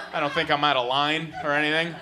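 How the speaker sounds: usual speaking voice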